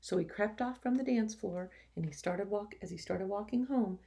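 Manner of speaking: lively, expressive storybook reading; reading to children. A narrator's reading. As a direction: read it to the class with energy. Teacher reads picture book aloud.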